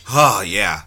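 ordinary talk, nothing marked

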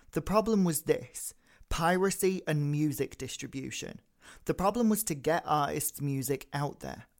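whispered, weighty podcast intro; dramatic quote reading. Acted. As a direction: read it like a dramatic cold open.

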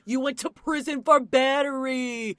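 high, slow Boston shouting; Boston accent. Pretend. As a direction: yell it like Matt in a Boston accent. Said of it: "you have to try the Boston accent".

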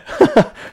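quick burst of laughter; short laugh. Real, not acted. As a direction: laugh it off mid-sentence. Laughs while telling a story.

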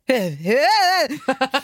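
high, loud burst of laughter. Real, not acted. Friends laughing at intruder story, loud high laugh. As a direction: break up laughing.

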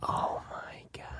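whispered, slow oh my God; shocked whisper. Real, not acted. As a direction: breathe it out in disbelief. Shocked at punchline.

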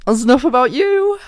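high, loud squealing laughter; laughing. Real, not acted. Giggling fit.

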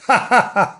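bright-pitched "ha ha ha"; hammed-up girlish storybook laugh; high staged laugh. Imogen laughing over her find, high staged laugh. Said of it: "with a laugh".

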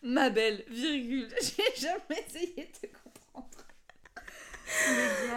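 laughing till tears; breathless with laughter; crying with laughter. Real, not acted. Laughing fit, near tears.

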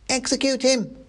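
very high mouse cry, little added volume; mock mob shout. A narrator's reading. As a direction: squeal for blood as one of the mob. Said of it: "shouted a voice".

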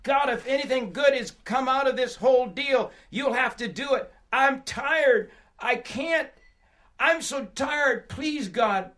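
high, desperate plea; exhausted cry for God's help. Acted. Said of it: "so I cry out".

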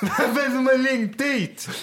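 slow, very high mock-drunk yell; acted drunken shout. Dead-drunk host hunting for the complainer, acted drunken shout. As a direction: drag out the plastered outrage, shouting. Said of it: "Skitfull står man".

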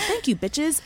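sassy thank-you to the former bosses; cheeky sign-off. Real, not acted. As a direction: toss it off with sass.

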